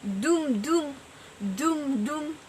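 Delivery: "Dum, dum" as two equally stressed beats; even double stress on each "dum, dum"; spondaic stress. Shown as a demonstration. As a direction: say "dum, dum" in pairs, weight on both, pause between pairs. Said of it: "combination of two stressed syllables".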